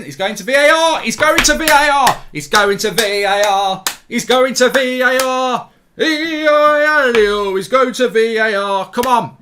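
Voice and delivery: urgent repeated shout at the screen; loud insistent shouting. Real, not acted. Watching VAR check, shouting.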